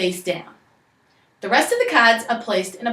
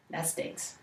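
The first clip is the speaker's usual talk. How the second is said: whispered aside; 5 semitones lower, 17 dB quieter than usual. Real, not acted. Whispered aside about card.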